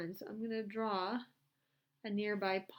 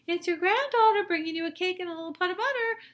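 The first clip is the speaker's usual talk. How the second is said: put-on high, louder little girl; high, louder storybook girl voice; 10 semitones higher, 11 dB louder than usual.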